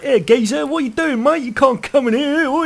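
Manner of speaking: high, hammed-up Cockney geezer; exaggerated high-pitched Cockney accent. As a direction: shout it like a mock Cockney. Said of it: "speaking to you in a Cockney accent".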